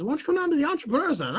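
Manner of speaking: quick, high imitation of an eager young guy; young guy's invitation. Young guy invites him to the center, quick and high. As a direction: toss out the offer fast and high. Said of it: "he says".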